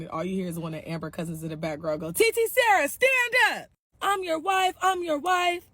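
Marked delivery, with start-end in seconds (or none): 2.1-5.6 very high, louder mocking cousin; mock her from the back.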